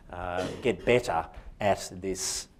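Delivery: normal talking voice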